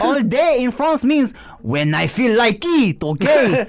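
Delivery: put-on indignant French accent; mock French accent. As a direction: lay it down in a fake French accent. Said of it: "we were doing the French accent".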